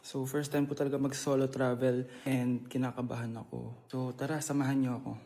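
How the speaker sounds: low, soft, downcast vlog voice; subdued, heartbroken. Acted. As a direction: confide quietly, as if nursing heartbreak. Staged heartbreak vlog for a prank. (malungkot at mahinang pagsasalita)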